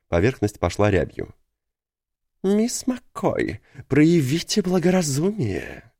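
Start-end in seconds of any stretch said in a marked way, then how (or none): 2.4-5.9 high, slow, unctuous pleading voice; slick oily man. A narrator's reading. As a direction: drawl it like a smug clerk.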